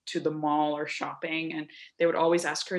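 ordinary talk

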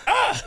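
sudden high yelp at a bite; audible yelp. Retold or imitated. Friend bites his shoulder mid-spar, sharp high yelp. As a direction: yelp as teeth sink in. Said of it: "audibly, I was like".

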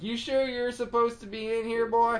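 high-pitched suspicious Southern twang; country twang. Acted. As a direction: challenge a stranger in a country twang. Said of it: "They all got a bit of a twang".

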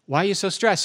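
ordinary talk, nothing marked

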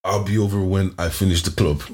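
ordinary talk, nothing marked

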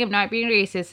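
ordinary talk

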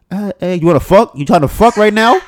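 hammed-up eager friend blurting; mock blunt pitch. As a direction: blurt it out with no tact. Imitating blunt friend closing.

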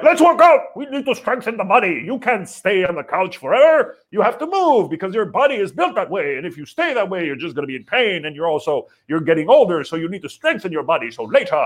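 high-pitched pep-talk in a put-on accent; workout [name]. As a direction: bark like a keen trainer with a mock foreign accent. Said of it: "I don't know why I'm speaking in this accent".

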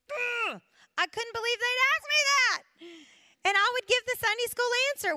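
high, loud exasperated groan of disbelief; exasperated outburst. Real, not acted. Telling the ladies how people's questions galled her, exasperated. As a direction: let the frustration burst out.

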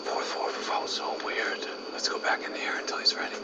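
hushed whisper. Real, not acted. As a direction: whisper so nothing hears. Whispering during investigation.

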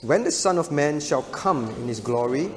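ordinary delivery

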